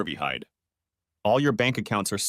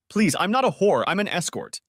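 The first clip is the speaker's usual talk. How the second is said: pleading; 8 semitones higher than usual. Acted. Wife pleading desperately.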